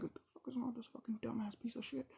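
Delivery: fast unvoiced whisper. Whispering to a friend about the rude cousin, fast and unvoiced.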